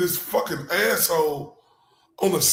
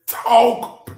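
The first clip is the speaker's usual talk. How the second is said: loud, high hype call; excited hollering at the stream; hype shout; 6 semitones higher, 6 dB louder, much slower than usual. Real, not acted. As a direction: yell it to cheer him on. Hyping up the lawyer.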